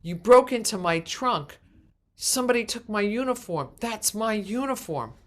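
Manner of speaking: loud, high, indignant demands; angry neighbor. Retold or imitated. Neighbor demanding his uniform back, loud and high. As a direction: insist hotly it's his uniform. Neighbor confronts her at funeral home.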